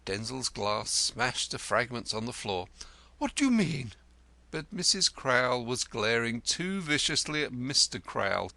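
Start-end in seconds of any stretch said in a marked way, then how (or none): 3.2-3.9 1 alarmed. A narrator's reading. Lodger alarmed.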